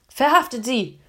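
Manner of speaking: guard captain ordering an arrest; commanding. A narrator's reading. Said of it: "befahl der Rotgesichtige".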